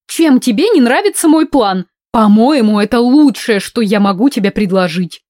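loud, relentless pressing; pushy and insistent. Acted. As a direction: press on like a tank. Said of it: "напирала с упорством танка".

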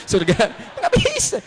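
laughing through the words; amused laugh breaking in. Real, not acted. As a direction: chuckle out the line. Laughing at own joke.

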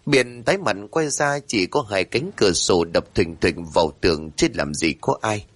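usual speaking voice

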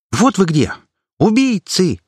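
high, loud accusing cry; outraged shout. Acted. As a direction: burst in shrieking accusations. Angry activist bursts in.

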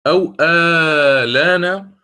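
آلان with the opening vowel held very long, very slow; long madd. Shown as a demonstration. Teacher models the elongated آلان for students, very slow and drawn out. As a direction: stretch the آ of آلان out slowly. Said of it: "فسنقتصر فقط على آلان".

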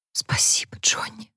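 very soft, voiceless whisper; whispered thanks. A narrator's reading. Harper thanking Johnny with tears in her eyes, whispering. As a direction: whisper it, barely audible. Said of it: "прошептала она".

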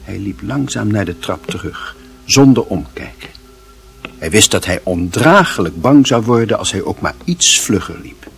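plain reading voice

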